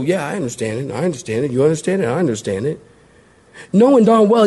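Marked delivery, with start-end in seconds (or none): 0.0-2.8 soft, lower mumbled agreement of a whole class; bluffing classmates. Acted. Pupils covering up that they are lost, soft bluffing voices. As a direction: quietly pretend to follow along. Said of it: "everybody in the class would go".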